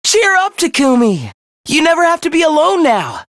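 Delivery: high, gleeful false comfort; mock cheering up. Villain promising Takumi he's never alone, mock cheer. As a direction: lift it into a taunting pep talk. Villain mockingly consoles victim.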